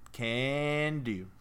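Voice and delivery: "Can" stretched very long, then a short "do"; drawn-out CAN. Acted. Ron's brief letter back to Harry, "Can" hugely drawn out. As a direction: hold the vowel of "Can" for ages before "do". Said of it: "It's, like, thirty".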